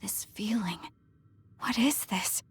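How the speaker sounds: shaken whisper. Acted. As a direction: whisper as it overwhelms her. Overwhelming memory, hushed.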